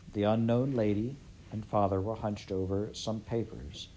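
ordinary delivery, nothing marked

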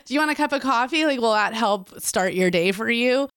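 high, quick and friendly, a casual hey-man; upbeat outreach worker greeting a stranger; friendly offer. Retold or imitated. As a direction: offer the coffee lightly and kindly.

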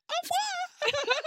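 tiny, very high drawn-out French goodbye; mock French coo, sky-high; imitated French farewell. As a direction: squeak it slowly like a smitten Frenchwoman. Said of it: "Like that".

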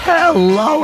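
ordinary delivery

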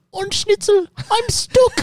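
put-on high squeaky German accent, way up. Retelling the movie scene to friends, high German accent. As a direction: squeal it like the cartoon German character. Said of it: "he goes".

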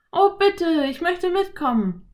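put-on very high, pleading girl; girl begging to come; begging Anne. A narrator's reading. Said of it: "bettelte Anne". (bettelndes Mädchen)

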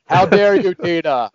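loud, high voice with no volume control; Will Ferrell character. Acted. Comparing the patient to an SNL sketch, voice-modulation shouting. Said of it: "it's like the Will Ferrell character".

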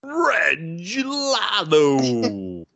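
high, very slow, drawn-out cry of joy; long sung-out delighted exclamation. Real, not acted. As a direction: stretch it out like a victory call. Delighted repeat of favorite name.

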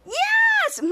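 very high, piercing shouted yes; super scream. Retold or imitated. Shadow answers the villain with a very high super scream. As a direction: scream it with all your power. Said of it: "super screams".

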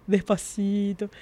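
slow and soft, creeping along; imitación lenta. Retold or imitated. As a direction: draw it out softly, very slowly. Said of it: "Se movía así". (despacito, bajito y lento)

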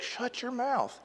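a hushed, dismissive shut-up; hushed snap. Retold or imitated. The crowd silences the beggar in a hushed snap. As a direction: mutter it down at him. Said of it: "they were like".

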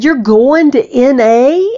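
high and loud disbelief; can't believe it, ask; amazed question. Retold or imitated.

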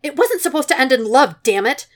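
loud, high, exasperated outburst; exasperated exclamation. A narrator's reading. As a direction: throw it out in frustration. Repeated line, exclaimed.

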